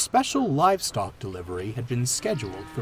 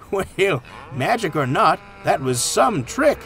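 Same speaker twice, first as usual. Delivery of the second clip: amused farmer, raised and lively; higher, drawn-out amused delight; chuckling; 6 semitones higher, 4 dB louder than usual. A narrator's reading. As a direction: voice it with a chuckle behind the words. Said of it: "chuckled the farmer".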